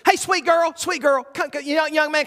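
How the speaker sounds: calling sweet wife. Retold or imitated. Wife calls the couple in.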